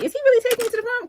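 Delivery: retelling her own jittery prom panic; anxious disbelief. Retold or imitated. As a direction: rush it out like a nervous teen. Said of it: "I was like".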